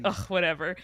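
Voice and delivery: usual speaking voice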